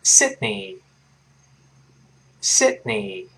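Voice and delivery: slow "Sydney" with a held D and EE ending; "Sydney", tiny stop after Syd, then NEE; model pronunciation. Shown as a demonstration. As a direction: say "Sydney" twice slowly for learners to copy. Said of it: "跟老师读一遍".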